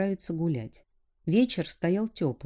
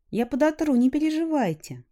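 put-on girl voice; 9 semitones higher, 5 dB louder than usual. A narrator's reading. Girl voice answers.

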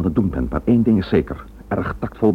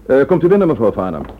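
raised, higher call toward the door; loud, higher summons to come in; 4 semitones higher, 6 dB louder than usual. Acted. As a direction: call her in from across the room. Calling the visitor into the room.

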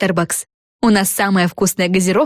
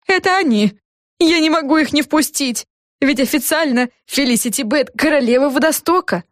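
higher, fretful character voice; flustered café owner; 8 semitones higher than usual. A narrator's reading. Mrs Clutterbucks seeing who is knocking, higher flustered voice. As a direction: fret in a raised voice.

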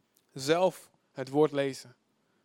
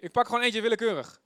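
ordinary talk, nothing marked

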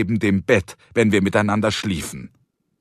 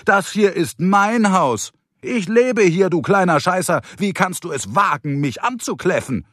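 high-pitched indignant tirade, easing down at the end; affronted, voice pitched up; outraged scolding; 9 semitones higher than usual. Acted. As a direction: tell off the dog in outrage. Man rails at barking dog.

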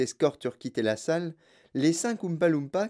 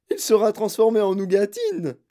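high, loud wail of alarm; howling; 7 semitones higher, 6 dB louder than usual. A narrator's reading. Mother fears for her son, howling. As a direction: yell the awful prediction. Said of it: "hurla Madame Gloop".

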